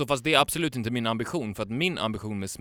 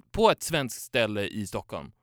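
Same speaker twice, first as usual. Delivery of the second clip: ordinary talk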